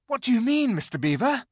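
put-on a boy out of breath climbing; panting boy. A narrator's reading. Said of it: "panted Peter".

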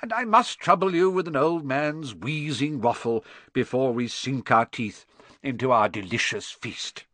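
lightly voiced genial elderly speechmaker; hint of a jovial old orator; cheerful old headmaster. A narrator's reading. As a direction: address the hall with measured ceremony. Narrator voices headmaster's feast speech.

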